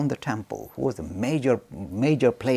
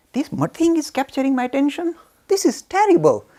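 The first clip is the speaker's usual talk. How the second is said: much higher and louder, revolted at the shawl; disgusted outburst; 12 semitones higher, 7 dB louder than usual. Retold or imitated. Ramakrishna at his own shawl, loud and disgusted. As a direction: spit out the disgust, loud and high. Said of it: "suddenly he became very disgusted".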